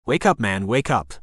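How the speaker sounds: ordinary delivery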